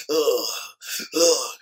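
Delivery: soft imitated pleasure groan; mock moan. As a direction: groan it like the creepy passenger. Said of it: "He started moaning like".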